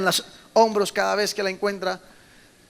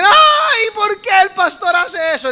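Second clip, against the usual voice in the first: much higher, louder crying shout; mock wailing protest; 11 semitones higher, 7 dB louder, slower than usual. People protest why the shepherd breaks the leg, mock wailing. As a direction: wail in exaggerated outrage.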